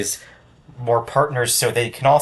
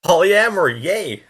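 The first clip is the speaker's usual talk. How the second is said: mock cheer; 8 semitones higher, 4 dB louder than usual. Cheering at the meme.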